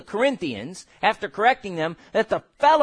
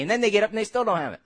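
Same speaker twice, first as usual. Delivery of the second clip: normal talking voice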